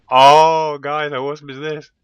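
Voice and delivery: gasp, then burst out in awe; real burst of delight; amazed. Real, not acted. Amazed at the build.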